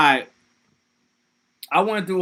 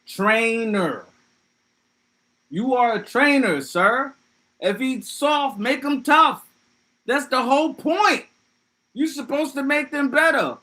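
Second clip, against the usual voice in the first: worked-up rant; 10 semitones higher than usual. Real, not acted. Streamer ranting at Ash for leaving Charmander, high indignant scolding. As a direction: scold him like a disappointed fan.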